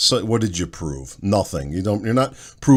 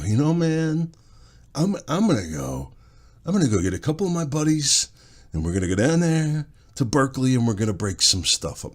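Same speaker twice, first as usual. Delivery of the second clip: put-on voice of a grown slacker still at home; twenty-seven-year-old living at mom's; 4 semitones higher than usual. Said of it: "twenty-seven-year-old still living at mom's house accent".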